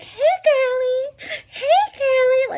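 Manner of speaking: very high, loud, drawn-out screech; mocked supervisor. Imitating the supervisor's favorite greeting, mocking high screech. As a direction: mimic her in a piercing slow falsetto. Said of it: "so many stories about her. She's like".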